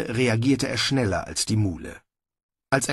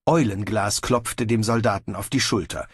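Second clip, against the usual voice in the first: usual speaking voice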